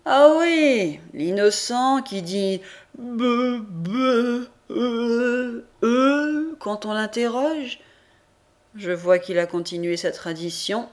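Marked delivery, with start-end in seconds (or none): 0.1-7.8 higher-pitched guard mimicking a stammering simpleton; mock the half-wit with slow bleats; prison guard. A narrator's reading. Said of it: "un gardien qui me dit".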